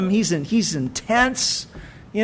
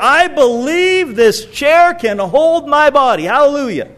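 raised, higher hammed-up proclamation with a laugh; preacher mocking a showy declaration of faith; 6 semitones higher, 4 dB louder than usual. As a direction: proclaim it over the top. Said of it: "raise my voice and declare".

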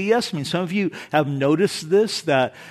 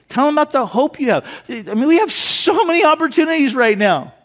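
high, loud, fast exhortation; preaching at full force; 10 semitones higher, 9 dB louder than usual. Acted. Pastor urging church to share Jesus, preaching at full force. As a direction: urge them on like a revival preacher.

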